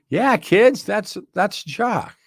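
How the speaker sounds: high, loud mock mother voice; hammed-up mom insisting it's the same dog; Patsy's insistence. Patsy assuring her kids the swapped dog is Jacques, high and loud mock.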